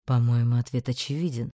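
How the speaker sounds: low, calm, dry retort; dry low male voice. Acted. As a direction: state it as plainly obvious. Einar answers drily.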